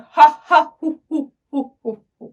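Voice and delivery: loud mock "ha ha", trailing into hoo-hoos; staged guffaw that fades away. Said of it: "laughing louder". (ज़ोर की नकली हँसी, फिर धीमी होती हुई)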